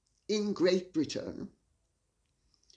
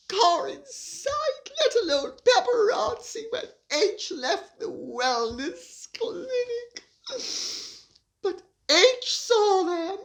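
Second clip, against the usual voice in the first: high, whiny fake-tearful rant with laughs; mock Meghan lament; 13 semitones higher, 4 dB louder than usual. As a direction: play a self-pitying duchess sobbing theatrically.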